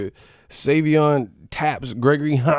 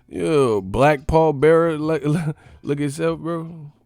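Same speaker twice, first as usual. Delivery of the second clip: ordinary talk, nothing marked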